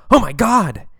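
high, loud startled cry; alarmed shout. Acted. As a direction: shriek in panic at the sight. Nurse sees fake seizure.

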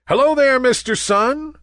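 high, loud sing-out hello; clouds' chorus greeting. A narrator's reading. Clouds greeting the Sun, high chorus voice. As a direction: call out a high greeting as a bunch of little clouds. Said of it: "said the clouds in chorus".

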